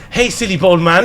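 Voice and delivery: lightly voiced child gleefully copying an insult; youngest child imitation. Youngest son walks in parroting mum's insult, cheeky. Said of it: "then my youngest walks in".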